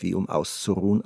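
plain reading voice

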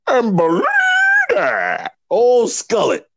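high, strained impression of a hype-man manager; Paul Heyman impression. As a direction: belt it out like a wrestling manager. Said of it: "Paul Heyman".

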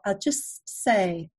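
usual speaking voice

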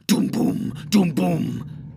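loud, doubled resonant booms; wild drumbeat imitation. A narrator's reading. Narrator voices drums breaking out wildly, loud booms. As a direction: pound out paired booming beats. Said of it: "The drumbeats broke out wildly".

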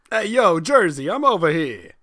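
Jersey accent. Shown as a demonstration. Friend demonstrates for the storyteller, Jersey accent. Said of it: "can you do a Jersey accent?".

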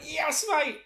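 hammed-up eager hero. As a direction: leap into heroics, thrilled. Rand eager to rescue.

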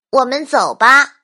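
put-on high, loud boy voice; child character. A narrator's reading. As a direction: call to a friend like a little boy. Boy Michael suggests leaving. (高而响亮的小男孩声音)

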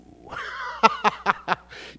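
high, loud burst of laughter. Real, not acted. Laughing at the verse just read.

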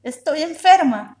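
put-on high, loud childish wail; a little girl's furious tantrum yell; tantrum shout. A narrator's reading. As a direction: kick and scream it like a child. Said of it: "Yayita patalea".

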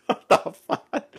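ordinary talk, nothing marked

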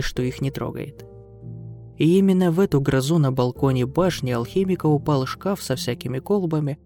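ordinary delivery

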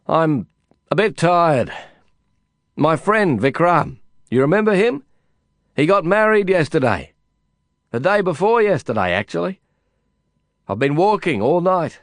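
lightly voiced narrator speaking up, a bit tired. A narrator's reading. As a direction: call out over a crowded room.

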